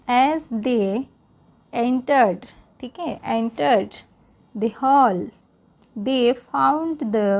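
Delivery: slow, careful read-aloud for learners; English read-aloud for Hindi-speaking students, slow. Shown as a demonstration. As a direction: read it slowly so learners can follow along.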